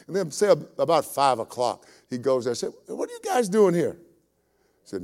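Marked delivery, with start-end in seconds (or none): 2.9-4.0 puzzled question. Acted. Owner finds idle workers.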